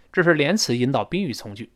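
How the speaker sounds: ordinary talk, nothing marked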